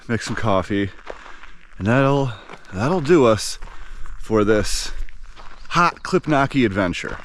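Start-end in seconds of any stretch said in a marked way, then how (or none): none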